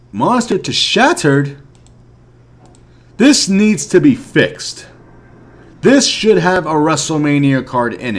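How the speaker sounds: real frustration, hammering each demand; fed-up player scolding the developers, loud and slow; angry rant. Real, not acted. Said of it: "I'm a little angry right now".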